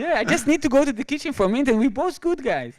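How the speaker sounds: high, loud and fast, in a changed voice; hammed-up waiter hurrying off; voicing the waiter. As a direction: rush it out like the eager waiter. Waiter voiced in retold story.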